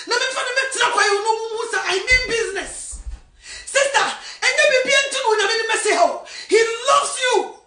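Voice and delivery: preaching shout. Acted. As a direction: proclaim it at full force. Shouted sermon climax.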